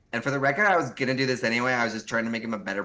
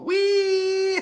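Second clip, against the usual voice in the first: gleeful squeal; 7 dB louder than usual. Acted. Playful cheer.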